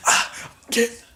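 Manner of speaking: high, strangled voice with gasps; hammed-up choked gasping; mock choking. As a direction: act out being choked, fighting for air. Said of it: "Shayna chokes her out".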